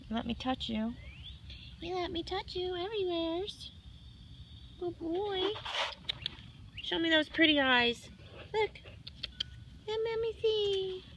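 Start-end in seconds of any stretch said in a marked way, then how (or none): 1.8-11.0 high, sing-song cooing at a cat. Real, not acted. Owner coaxing her cat Marvin over, high cooing baby talk. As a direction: coo sweetly to coax the kitty closer.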